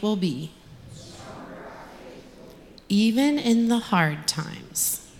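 slow, earnest prayer voice. Acted. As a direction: pray it slowly and gently. Leads children in prayer.

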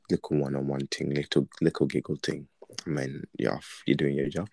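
normal talking voice

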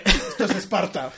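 loud hammed-up war cry; mock battle cry. Joking with friends about kicking, mock battle cry. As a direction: belt it out like a movie warrior, for laughs.

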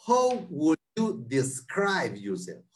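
drawn out slowly word by word; slow demo. Shown as a demonstration. Teacher models the interview question for students, slowly. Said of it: "Esta otra pregunta".

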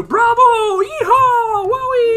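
very high, loud wild cheers; over-the-top cheering. Retold or imitated. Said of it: "the judges erupt into".

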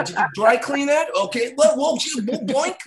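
high, silly put-on voice for the pawn dealer; goofy high dealer voice. Pawn dealer haggling with a seller, high and goofy.

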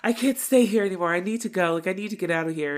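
hammed-up breakup outburst; mock lover's quarrel. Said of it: "like, a lover's quarrel".

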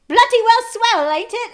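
high, loud hammed-up British accent; obnoxious British accent. Telling friends about the play, mocking the British accent. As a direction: overdo a posh British accent. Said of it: "talking obnoxiously like that".

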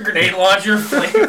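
ordinary delivery

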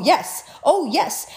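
ordinary talk, nothing marked

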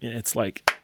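ordinary talk, nothing marked

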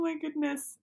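ordinary talk

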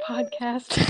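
put-on anime girl voice. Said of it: "this is the anime girl voice".

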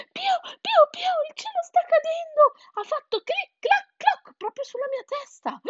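very high squeaky chick voice; panicked chick. A narrator's reading. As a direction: cheep out the alarming news. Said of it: "disse Sansone". (pulcino agitato, voce acutissima)

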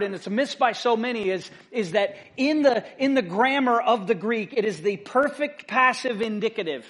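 normal talking voice

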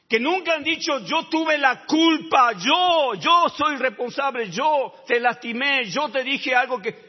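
loud, high, slowed preaching cry; preacher at full force; fiery preaching. Acted. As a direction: hammer each phrase to the congregation.

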